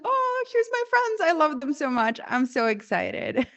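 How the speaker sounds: high, gushing greeting that settles down; hammed-up delight. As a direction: gush over seeing beloved friends. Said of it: "I saw my friends and I was like".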